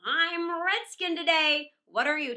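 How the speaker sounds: loud, slow shout across the fight; booming battle call. Acted. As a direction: call it out to everyone. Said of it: "he called out".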